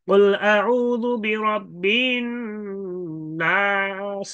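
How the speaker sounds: slow chanted tilawat, last vowel faintly voiced; Quranic recitation with rawm. Shown as a demonstration. Teacher demonstrating stopping on নাস with a faint vowel. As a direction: recite কুল আউযু বিরাব্বিন slowly, drawn out and high. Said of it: "কি করতে চায় রুম".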